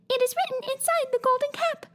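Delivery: very high, tiny squeaky voice; squeaky mouse queen. A narrator's reading. Mouse queen greets Dorothy in a tiny high squeak. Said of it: "squeaky little voice".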